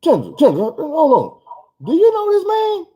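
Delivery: rising, pleading to hold on; high and urgent, trying to stop her; alarmed boyfriend. Retold or imitated. Urging girlfriend to stop.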